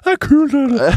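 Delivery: high, loud strained squawk; mock lost voice. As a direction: force it through a pretend broken voice. Leker at stemmen er borte. (påtatt sprukken, høy stemme)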